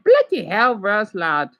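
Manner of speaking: mock British accent, lightly done; a playful stab at a British accent. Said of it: "I could pull up a British voice like that".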